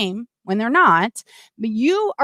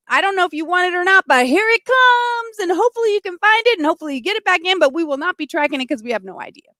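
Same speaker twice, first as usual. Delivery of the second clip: mock official brush-off; 6 semitones higher, faster than usual. Mocking officials sending absentee ballots.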